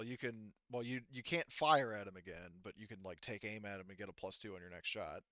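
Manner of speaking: ordinary talk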